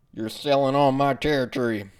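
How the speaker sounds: a put-on drug dealer accent, high and loud; menacing street dealer, hammed up. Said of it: "What kind of a drug dealer sounds like that?".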